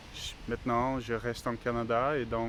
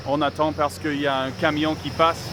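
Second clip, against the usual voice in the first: louder and higher over traffic noise; raised voice; 4 semitones higher, 10 dB louder than usual. Real, not acted. Vlogger telling viewers to wait for a truck, raised voice. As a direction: speak up over a passing truck.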